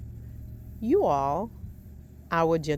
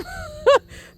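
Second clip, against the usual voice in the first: big high laugh; 7 dB louder than usual. Real, not acted. Laughing at son's answer.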